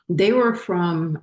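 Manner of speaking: ordinary talk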